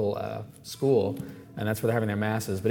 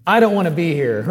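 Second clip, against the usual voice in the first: blunt honest answer, laughing; 5 semitones higher, 7 dB louder than usual. Retold or imitated. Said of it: "And my answer was".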